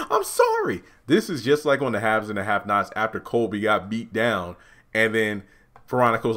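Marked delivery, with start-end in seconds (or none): 0.1-0.8 very high squeaking apology; squeaky pleading. Retold or imitated. Andy apologizing again, squeaky and scared. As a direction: whimper it out.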